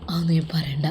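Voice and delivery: hushed whisper to his mother; a secretive, hushed whisper. Acted. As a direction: whisper it so nobody hears. Son hushes mother's gossip.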